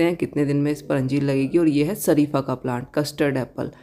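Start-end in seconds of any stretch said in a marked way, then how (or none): none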